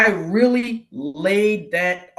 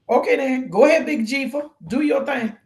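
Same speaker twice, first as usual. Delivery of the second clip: higher-pitched, animated go-ahead; playful surrender; 7 semitones higher, 3 dB louder than usual. Retold or imitated. Handing control to God after being reassured, playfully yielding. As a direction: cheer God on with breezy acceptance.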